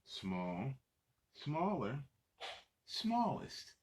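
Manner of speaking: put-on lively read-aloud for little kids; reading to children. Adult reading a picture book to young children, lively storytelling. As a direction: read it out bright and animated for the kids.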